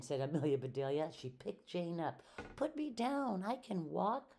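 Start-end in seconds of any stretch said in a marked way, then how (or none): 2.6-4.4 mock scream. A narrator's reading. Jane, picked up and carried, mock screamed protest. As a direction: squeal it in a storyteller's mild scream. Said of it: "screamed Jane".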